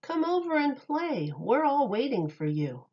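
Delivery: ordinary delivery, nothing marked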